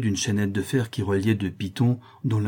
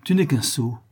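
scornful, slightly raised pitch; disdainful irritation; 4 semitones higher than usual. A narrator's reading. Cécilie leaving the grille, disdainful and irritated. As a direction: dismiss with feigned pique. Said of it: "une apparence de dépit dédaigneux et irrité".

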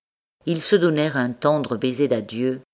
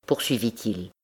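ordinary delivery

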